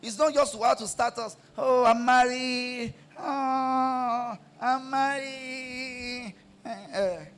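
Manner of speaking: high, loud, shouted sermon; fiery preaching. Acted. Pastor exhorting wives to support husbands, shouted and high.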